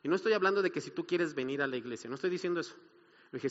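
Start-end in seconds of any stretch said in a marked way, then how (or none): none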